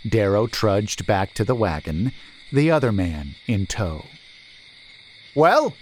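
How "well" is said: sharp high shout across the road. A narrator's reading. Merchant shouting to his returning men, loud. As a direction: call out loud and impatient. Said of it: "shouted Stahl".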